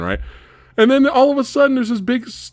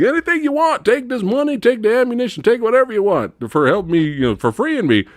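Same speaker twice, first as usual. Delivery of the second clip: put-on high, eager old man offering everything; grateful uncle; 9 semitones higher than usual. Rescued uncle offering the hero anything, high eager. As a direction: gush the offer like a rescued oddball. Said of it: "he's like".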